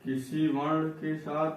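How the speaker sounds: slow, low, soft word-by-word dictation; quiet, drawn-out teacher dictating for note-takers. Shown as a demonstration. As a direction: dictate it piece by piece with long pauses.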